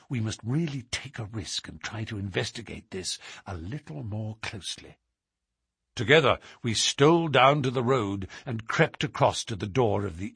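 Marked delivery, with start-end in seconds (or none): 0.1-5.0 hushed. Acted. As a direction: keep it down while they watch the inn. Hidden watchers talking low.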